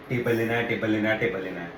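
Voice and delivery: low, repetitive mock nagging chant; mock girlfriend's relentless low-pitched pestering; mimicked nagging. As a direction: drone it over and over, imitating a nag.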